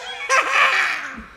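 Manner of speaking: high loud evil cackle; maniacal villain laugh. Acted. Suitor cackling after joking about the wolves, evil laugh. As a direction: burst into a mad villain laugh.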